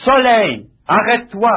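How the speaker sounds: commandement fort et haut, sans crier; ordre au soleil. Retold or imitated. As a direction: ordonne au soleil avec autorité. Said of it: "Il commanda". (ordre puissant lancé au soleil)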